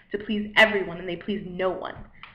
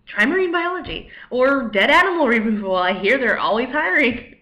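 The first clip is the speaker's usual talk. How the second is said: blunt editor's verdict, growing louder; plain blunt rejection; 6 dB louder than usual. Acted. Editor telling her to quit writing, blunt. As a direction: turn someone down flatly and forcefully. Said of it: "would just say plainly, once and for all".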